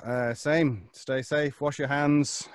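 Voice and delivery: usual speaking voice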